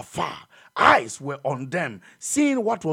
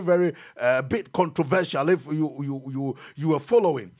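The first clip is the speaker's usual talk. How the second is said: normal talking voice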